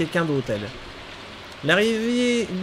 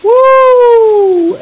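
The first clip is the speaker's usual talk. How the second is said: excited whoop; 16 dB louder than usual. Real, not acted. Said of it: "excited whoop".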